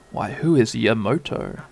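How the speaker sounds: usual speaking voice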